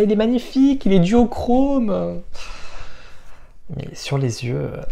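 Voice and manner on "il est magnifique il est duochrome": enthusiastic gushing. Real, not acted. Gushing over eyeshadow swatch.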